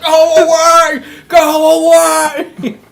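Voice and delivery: hammed-up desperate shout; mock anguished yell. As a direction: howl at the kids to leave. Kids pounding on van.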